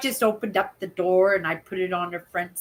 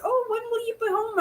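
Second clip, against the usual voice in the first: much higher and quick, a light mock lady voice; lightly voiced eager woman; imitating the lady; 13 semitones higher, 4 dB quieter, faster than usual. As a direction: squeak it out fast like the eager buyer. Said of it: "she says".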